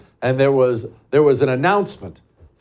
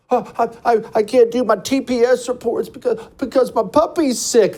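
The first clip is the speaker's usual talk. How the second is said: high-pitched imitation of a weepy worker; put-on sniveling excuse; mock tearful whining; 10 semitones higher than usual. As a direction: whimper a feeble excuse, hammed up. Mocking a worker crying at work.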